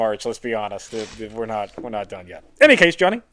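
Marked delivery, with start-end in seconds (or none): 0.3-2.4 resigned mutter. Real, not acted. Resigned aside about winter.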